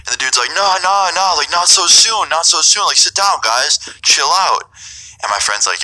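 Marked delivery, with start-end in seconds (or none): 0.5-4.7 high, drawn-out mock pleading; coaxing drunk host. Drunk host keeping guests from leaving, high-voiced mock coaxing. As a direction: imitate a drunk talking guests out of leaving, pitch well up.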